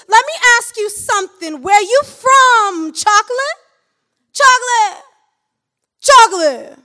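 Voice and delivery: loud, high, leering catcaller voice; hollering pickup lines at a passing woman; street catcaller. Acted. A loud, high catcaller shouting at a woman on the street.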